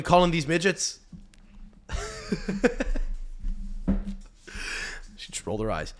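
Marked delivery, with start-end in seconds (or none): 1.9-5.1 long high laugh; helpless drawn-out laughter. Real, not acted. Host laughs at own question.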